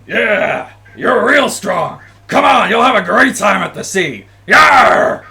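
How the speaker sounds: rally them with a big hearty shout; high, full-volume pirate bellow; loud, boisterous. Acted. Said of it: "he's got this loud, boisterous voice".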